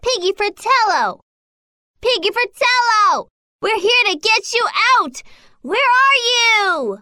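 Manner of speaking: shouting. Acted. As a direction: call out across a rubbish heap for someone lost. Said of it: "and shouted".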